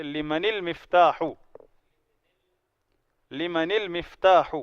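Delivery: لمن المفتاح linked slowly as limani-lmiftah; slow, joining لمن to المفتاح with a kasra; joined Arabic reading. Shown as a demonstration. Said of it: "پہلے کو کسرہ دیں گے".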